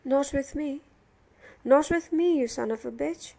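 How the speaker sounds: building from firm refusal to loud insult; angry refusal. A narrator's reading. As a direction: reject him with rising hostility. Prisoner rejects Billy with abuse.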